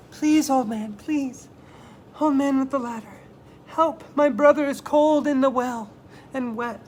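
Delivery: high, loud pleading; begging plea. Acted. Chang begs the old man for help, high and loud. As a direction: beg him to hurry.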